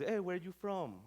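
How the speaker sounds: ordinary talk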